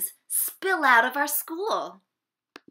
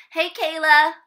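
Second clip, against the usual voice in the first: loud, higher friendly call across the room; 6 semitones higher, 11 dB louder than usual. Acted. Child greeting classmate Kayla across the room, calling out. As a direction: hail a friend from afar.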